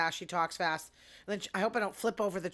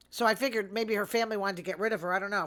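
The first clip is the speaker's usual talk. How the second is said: normal talking voice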